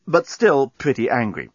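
ordinary delivery, nothing marked

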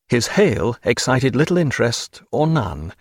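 ordinary delivery, nothing marked

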